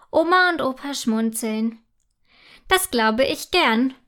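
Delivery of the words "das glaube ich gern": ordinary delivery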